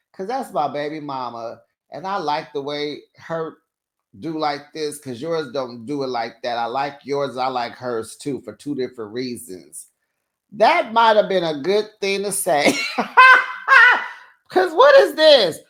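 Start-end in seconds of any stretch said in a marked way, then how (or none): 0.1-9.9 lower, smug mock boyfriend voice; a lazy lowered voice for the cheater; lower, mock cheating boyfriend. Host voicing what the boyfriend should have said, lower and smug. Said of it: "I wish he would have just said". 12.8-14.1 big high laugh. Real, not acted. Hosts cracking up at the show.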